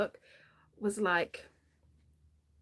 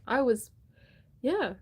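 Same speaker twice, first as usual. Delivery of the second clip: ordinary talk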